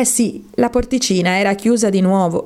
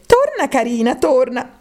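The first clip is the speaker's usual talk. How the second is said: lightly mimicked coaxing grown-up, a bit higher; coaxing call down the hole; 6 semitones higher than usual. (voce che chiama dolce dall'alto, appena accennata)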